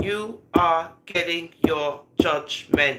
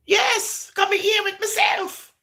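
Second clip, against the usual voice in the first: imitated reply. Quoting the voice heard on call.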